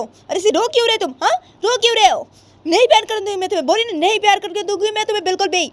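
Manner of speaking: high, agitated scolding; annoyed Uma protesting. Acted. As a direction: push someone away with a high-pitched refusal. (झुँझलाई, बहुत ऊँची आवाज़ में डाँट)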